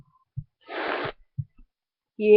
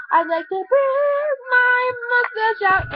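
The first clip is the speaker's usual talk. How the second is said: excited; 9 semitones higher than usual. Real, not acted. Kid showing off his fake mustache, high and excited. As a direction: pitch it way up with glee.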